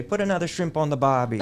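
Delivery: jokey Aussie slogan, said with a laugh; mock Australian accent. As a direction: ham up an Australian cliché. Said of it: "I would joke with him and say".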